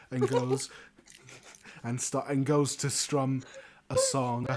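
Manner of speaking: usual speaking voice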